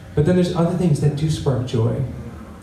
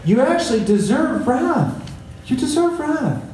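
lightly voiced prophet, higher and stern; a hint of a stern prophet handing down judgment; voicing Zephaniah; 8 semitones higher than usual. Preacher voicing Zephaniah to the congregation, a touch higher and stern. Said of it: "He's saying".

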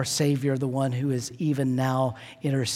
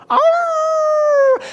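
very high, loud, sustained dog howl; imitated dog howl; 10 dB louder than usual. Dog howling back on command, loud imitated howl. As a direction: answer with a full-out howl, like a small dog. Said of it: "and he would go".

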